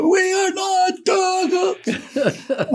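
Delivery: high, long-drawn mock wail; anguished cry to heaven. Guest acting out the journalists' statement, mock anguished cry to heaven. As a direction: wail it upward as a hammed-up plea, stretching every word. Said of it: "on his knees while raising his fists to heaven".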